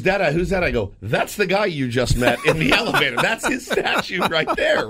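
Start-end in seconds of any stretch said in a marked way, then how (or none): 0.0-0.6 put-on high, curious child's question; light imitation of a child asking; curious kid. Son asking at the statue. 1.0-4.9 high, laughing through the words; can't stop chuckling at the memory; real laughter while talking. Real, not acted. Laughing retelling statue moment.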